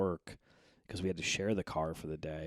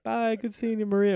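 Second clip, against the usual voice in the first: dad imitating sweetness; 9 dB louder than usual. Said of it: "He's like".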